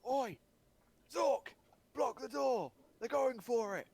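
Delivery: loud, high-pitched bellow to an ally; villain's shout. Acted. Game master as a guard warning Zork, shouting. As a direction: yell the alarm across the room. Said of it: "He's then gonna shout".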